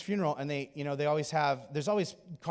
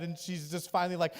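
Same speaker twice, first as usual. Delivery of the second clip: ordinary talk, nothing marked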